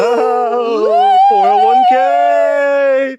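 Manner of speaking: high squealing dread over money; mock fright. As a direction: shriek it like it's a horror.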